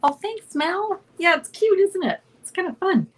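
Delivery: giddy high thanks; delighted thanks. Real, not acted. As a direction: gush back at a chatter. Thanks chatter in live chat.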